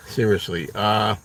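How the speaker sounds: normal talking voice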